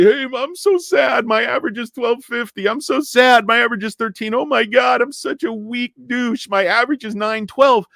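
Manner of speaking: high, mocking whine; whiny viewers. Imitating viewers moaning about their average, mocking high whine. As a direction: mimic self-pitying complainers in a high voice. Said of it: "you guys all say".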